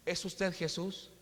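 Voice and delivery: put-on slow, wondering child's question; lightly voiced little girl. Blind girl asking the businessman in slow wonder. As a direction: ask it slowly, in innocent awe.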